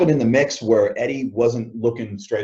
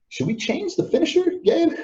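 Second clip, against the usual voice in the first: much higher, light mock voice of a worried partner; imitating Eddie. Eddie nervously asking Gabe before the match, in a high mock voice. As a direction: squeak it up like an unsure buddy.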